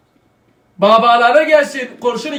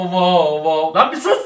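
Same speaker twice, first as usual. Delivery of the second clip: ordinary delivery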